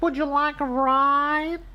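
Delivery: very high, slow little truck voice; Blue. Acted. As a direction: offer it in a tiny sweet squeak. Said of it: "said Blue".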